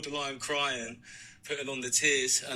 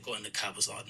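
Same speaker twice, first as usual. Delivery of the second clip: ordinary talk, nothing marked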